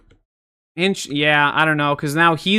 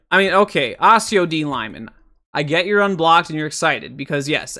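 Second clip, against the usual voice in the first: ordinary delivery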